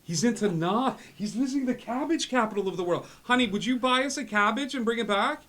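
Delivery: high, booming dad on a bad line; loud excited dad. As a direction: shout into the stone as an eager dad. Plays father on sending stone.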